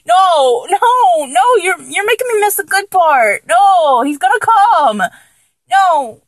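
high, drawn-in complaint, pleading no; whiny protest. Acted. Complaining to a lover about missing the good part, whiny.

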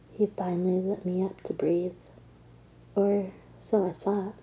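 usual speaking voice

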